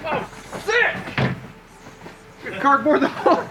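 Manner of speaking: high laughing disbelief. Real, not acted. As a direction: laugh at the bad luck. Cardboard gone, he laughs.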